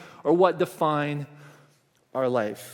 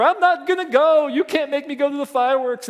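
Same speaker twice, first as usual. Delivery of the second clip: mock child tantrum; 6 semitones higher, 3 dB louder than usual. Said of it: "screaming bloody murder".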